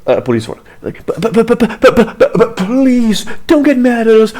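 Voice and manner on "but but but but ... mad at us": high, stuttering mock plea; sputter, then beg in an exaggerated whine; mock grovelling. Officer begging not to anger his briber, stammering grovelling. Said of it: "Instead, he's like".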